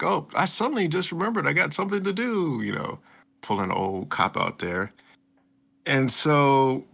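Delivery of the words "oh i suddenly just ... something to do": high, breezy fake excuse; playful imitation of a sudden excuse; mock excuse voice. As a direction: brush it off in a put-on high voice. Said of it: "he was like".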